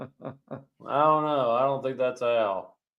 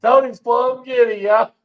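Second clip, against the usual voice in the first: high, loud, laughing tease; gleeful teasing; 8 semitones higher, 6 dB louder than usual. Real, not acted. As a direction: crow it to the whole chat. Teasing Tony to the viewers.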